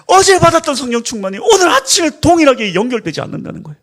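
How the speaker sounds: ordinary talk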